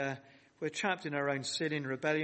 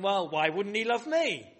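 mock cocky, louder, pitched-up question; self-assured protest; 4 semitones higher, 5 dB louder than usual. Preacher voicing the congregation's thought, mock self-assured. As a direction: play an imagined sceptic sure they deserve love. Imagined self-satisfied reply to God's love.

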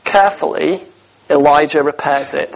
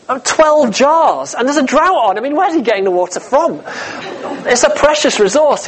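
mock incredulity; 7 semitones higher than usual. Preacher to congregation about water in a drought, mock astonished and higher. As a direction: sound amazed at such waste.

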